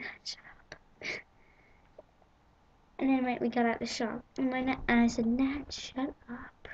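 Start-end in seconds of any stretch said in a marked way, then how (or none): 0.0-0.7 hushed embarrassed whisper; whispered plea. Retold or imitated. Embarrassed by nan in shop. 5.3-6.7 slow whispered plea to nan; whispered scolding outside the shop. Retold or imitated. Embarrassed after leaving shop.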